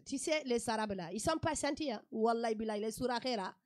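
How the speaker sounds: put-on loud, emphatic West African accent; hammed-up Malian accent. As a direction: warn her like a Malian auntie, full voice. Mimics Malian friends' warning.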